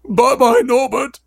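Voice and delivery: high, loud, wailing farewell; acted tearful goodbye; sobbing Hagrid. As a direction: wail it like a heartbroken keeper, hammed up. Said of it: "Hagrid sobbed".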